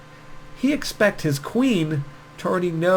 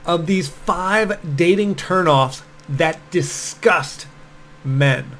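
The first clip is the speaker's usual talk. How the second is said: slow announcer intro; 4 semitones higher, 3 dB louder, slower than usual. Shown as a demonstration. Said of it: "intro hook read slowly".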